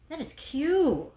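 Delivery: long, savoring "cute"; slow drawn-out delight. Real, not acted. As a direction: stretch it out slowly, delighted. Excited over wreath.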